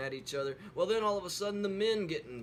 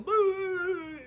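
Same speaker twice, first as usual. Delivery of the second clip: high, loud fake sob wail; hammed-up crying boo; mock bawling; 7 dB louder than usual. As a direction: wail like a crying little girl. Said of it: "Susie's over there just bawling".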